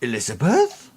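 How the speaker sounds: lightly voiced old man asking, rising at the end; a hint of a confused old man, questioning; old man's query. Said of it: "looks at you and says".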